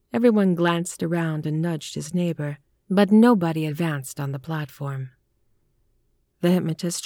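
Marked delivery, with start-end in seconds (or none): none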